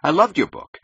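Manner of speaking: high, loud, stung insistence; angry snap. Acted. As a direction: protest hotly that you mean it. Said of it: "he snapped angrily".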